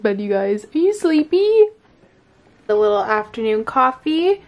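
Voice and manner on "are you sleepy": much higher pet voice; talking to puppy. Real, not acted. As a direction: draw it out high to a sleepy puppy. Talking to her sleepy dog.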